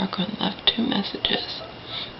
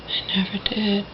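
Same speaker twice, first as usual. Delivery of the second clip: ordinary talk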